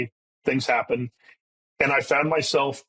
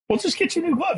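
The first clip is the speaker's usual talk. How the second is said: high-pitched incredulous dad; mock exasperation; faster than usual. Dad retelling his offer of a new glove to his son, mock exasperated. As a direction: squeak it out in mock disbelief.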